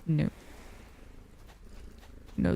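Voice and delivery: usual speaking voice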